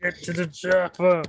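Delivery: high, strained Austrian accent attempt; bad Schwarzenegger. Co-host showing up the host, bad high Austrian accent. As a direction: shout the chopper line Arnold-style, badly. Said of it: "Wow, that was even worse".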